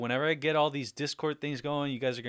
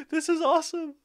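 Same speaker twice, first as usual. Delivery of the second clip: excited; 14 semitones higher than usual. Real, not acted. Excited sign-off after stream.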